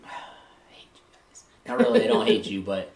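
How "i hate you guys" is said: whispered mock grudge, slow and under the breath; mock hateful whisper. Host to the silent audience that stopped asking questions, mock hateful whisper. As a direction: whisper it slowly, barely audible, in pretend hate. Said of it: "I'm like".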